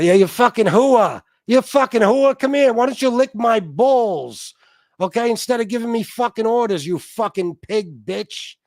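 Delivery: high, loud angry ranting voice; loud gung-ho soldier. Retold or imitated. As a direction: bark it at her like a furious grunt. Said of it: "I can't do his voice right now".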